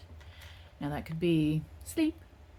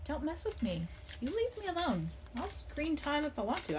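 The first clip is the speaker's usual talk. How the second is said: put-on high, protesting kid voice; rebellious teen pushing back; defiant whiny kid; 8 semitones higher, 6 dB quieter than usual. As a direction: play a child refusing to give up the screen. Defiant reply to "reduce screen time.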